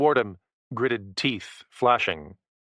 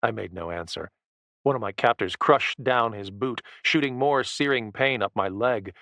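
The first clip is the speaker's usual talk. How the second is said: ordinary delivery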